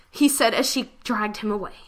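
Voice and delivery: usual speaking voice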